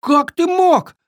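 high-pitched reproachful cry; indignant outburst. A narrator's reading. Friends confront the glutton, crying out indignantly. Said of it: "накинулись на обжору все разом".

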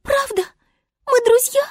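very high, joyful little voice; tiny high-pitched glee; delighted star. Acted. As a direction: ask in hopeful delight. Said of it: "обрадовалась звезда".

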